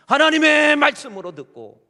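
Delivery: high, loud shout of the phrase; preacher stressing how to hear the word, shouting; loud emphasis. Real, not acted. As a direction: cry it out to the congregation.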